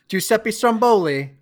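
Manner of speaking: mock Italian accent; bad Italian accent. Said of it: "sorry for the very bad Italian accents".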